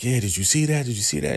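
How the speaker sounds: high-pitched excited questioning, imitated; retold agitated asking. Retold excited reaction to a mishap.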